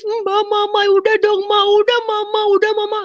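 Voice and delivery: very high, loud wailing plea; imitated crying. Younger sibling begging mother to stop beating, imitated crying. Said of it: "Dia nangis".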